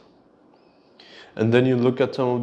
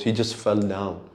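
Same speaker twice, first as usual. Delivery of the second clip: ordinary talk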